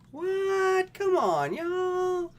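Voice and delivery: very high, loud disbelieving squeal; shocked squeal. Real, not acted. Shocked reaction to reading.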